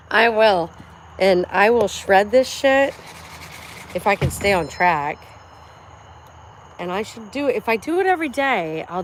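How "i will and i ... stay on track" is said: determined. Real, not acted. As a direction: promise it loudly. Determined vow while working out.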